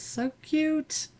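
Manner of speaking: high squeaky delight over a cute nail; high delighted squeal. Real, not acted. Admiring her own little nails, high delighted squeal. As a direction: squeal it way up, charmed.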